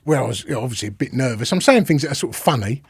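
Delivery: usual speaking voice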